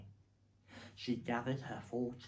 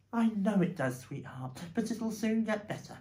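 soothing, higher motherly voice; comforting mother; 8 semitones higher, 5 dB louder than usual. A narrator's reading. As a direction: reassure him gently. Mother comforts hurt husband.

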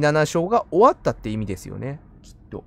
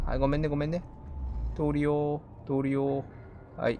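much softer, gentle pleading; soft apologetic coax; 9 dB quieter than usual. Real, not acted. Sneaking past the giant creature, hushed soothing apology. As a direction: tiptoe past it with a hushed sorry.

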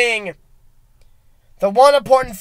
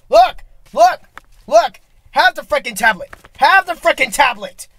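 heated rant; 4 semitones higher, 3 dB louder than usual. Acted. Ranting about phone.

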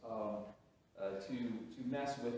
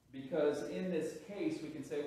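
ordinary talk, nothing marked

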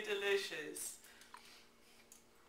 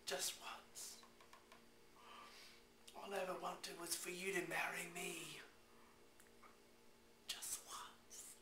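whispered plea; 16 dB quieter than usual. Acted. Pleading after angry rant.

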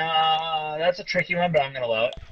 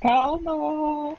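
high sing-song call; 12 semitones higher than usual. Real, not acted. Calling on the player.

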